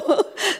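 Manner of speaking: small high breathy chuckle; soft laugh. Real, not acted. Wife's soft chuckle as the story ends.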